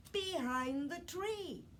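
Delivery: usual speaking voice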